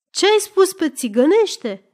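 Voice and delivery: high, loud interrogation; angry howl. A narrator's reading. Lefter challenging what the girl said, angry yell. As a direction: yell the suspicious question. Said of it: "urlă domnul Lefter".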